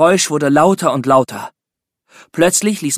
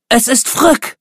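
much higher, delighted recognition; joyful cry; 11 semitones higher, 4 dB louder, slower than usual. A narrator's reading. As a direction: cry out the friend's name in joy. Ellie recognizes the crashed alien. (freudiger Ausruf, viel höher)

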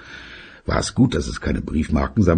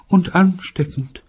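mother voice. Acted.

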